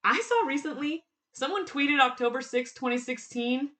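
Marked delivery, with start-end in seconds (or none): none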